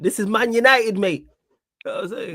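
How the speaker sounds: high Mancunian "This is Man United"; Manc accent. Shown as a demonstration. Retaking the line for mates in a Manc accent. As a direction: say it like a proper Manc. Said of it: "I'll say it with the man accent".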